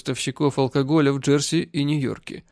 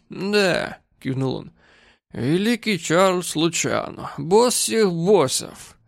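very slow, mocking title; hammed sarcastic drunk; drunken sneer; 3 semitones higher, much slower than usual. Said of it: "он был слишком пьян".

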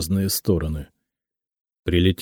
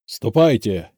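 curt bark of dismissal; brusque, mildly raised command; barked order; 3 semitones higher, 3 dB louder, slower than usual. A narrator's reading. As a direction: bark it like an angry boss. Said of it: "рявкнул он".

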